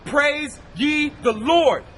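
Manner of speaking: ordinary delivery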